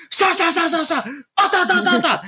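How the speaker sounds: high, staccato imitated scrubbing; furious scrubbing noises. Indignant wife cleaning the bathroom, high mimicked scrubbing noises. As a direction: fire off syllables like frantic scrubbing. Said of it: "me fui a limpiar el baño, así".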